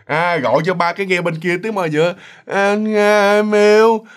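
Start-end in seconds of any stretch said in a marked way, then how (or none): 2.5-4.0 put-on drawn-out, louder drunken greeting; imitated drunk on the phone; tipsy husband. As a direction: slur a loving hello like a tipsy man. Acts tipsy husband answering phone.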